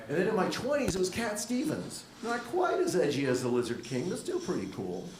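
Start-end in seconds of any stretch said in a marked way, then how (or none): none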